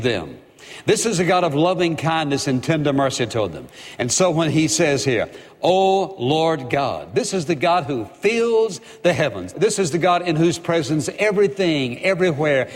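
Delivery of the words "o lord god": slow, solemn O Lord God; each word of "O Lord God" drawn out slowly; drawn-out invocation. Acted. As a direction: stretch out "O Lord God" reverently. Preacher reads prayer opening slowly.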